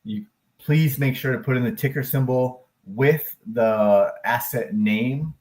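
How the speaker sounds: ordinary talk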